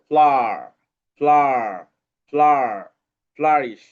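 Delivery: ফ্লার hammered out loud before joining ফ্লারিশ. Shown as a demonstration. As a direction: break the word ফ্লারিশ into parts, the ফ্লার part loud. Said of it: "এখানে আর আসছে কিন্তু".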